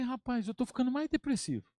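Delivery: high, soft mock complaint; queixa cômica. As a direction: act out comic dismay, high and small. Queixa cômica encenada ao amigo. (lamento cômico, agudo e baixinho)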